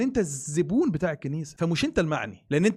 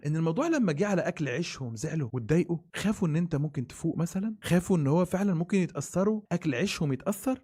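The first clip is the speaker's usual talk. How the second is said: ordinary talk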